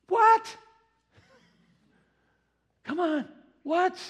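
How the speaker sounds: mock outrage at a lukewarm believer; incredulous what. Preacher reacts to complacent believer.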